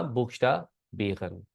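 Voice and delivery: बीगन with R as a throaty gh, said slowly; slow, separated demo with back-of-throat R in बीगन; guttural R. Shown as a demonstration. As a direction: make the R of बीगन a soft g from the throat. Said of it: "दो तीन तरह से इसकी sound".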